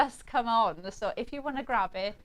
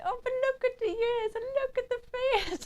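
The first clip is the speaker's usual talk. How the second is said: high mock coo; playful high cooing impression; imitating Taz cooing. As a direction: do Taz squealing at cute faces. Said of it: "Taz going".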